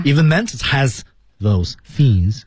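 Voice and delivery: slow, careful English line reading; slow down to get through each th. Shown as a demonstration. Co-teacher testing the tricky phrase, slowed down. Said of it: "语速就下".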